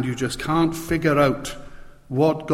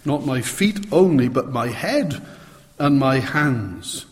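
ordinary delivery

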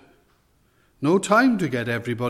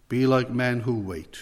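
normal talking voice

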